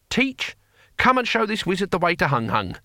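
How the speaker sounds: high-pitched calling out. A narrator's reading. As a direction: summon someone across a clearing. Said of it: "He raised his voice".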